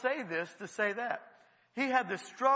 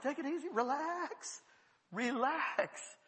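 higher, drawn-out "relax"; placating; 6 semitones higher, 3 dB quieter, slower than usual. Acted. Preacher to the angry man, placating. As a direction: soothe him with stretched words. Calming the man who got mad.

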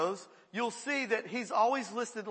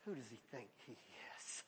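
much lower and much softer, a scornful murmur; contemptuous mutter; 10 semitones lower, 22 dB quieter than usual. Retold or imitated. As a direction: sneer it quietly to yourself in scorn. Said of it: "filled with contempt".

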